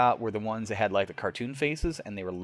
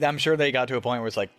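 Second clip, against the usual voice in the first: normal talking voice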